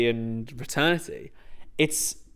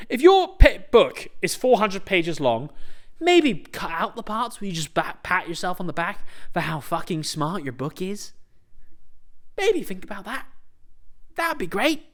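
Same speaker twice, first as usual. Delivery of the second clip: higher, snide, mocking suggestion; passive-aggressive higher tone; sarcastic advice; 5 semitones higher than usual. Real, not acted. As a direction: needle the author.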